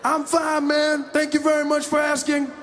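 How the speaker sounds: high, loud, mock polite; hammed-up polite suspect; suspect replying. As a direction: answer with exaggerated politeness.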